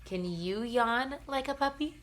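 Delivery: ordinary delivery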